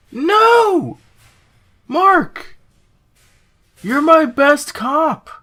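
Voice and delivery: hammed-up despair. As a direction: overact the heartbroken boss. Best cop quits.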